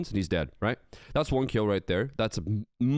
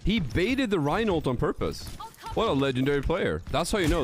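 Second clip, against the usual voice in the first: excited; 12 semitones higher than usual. Real, not acted. Cheering a risky play.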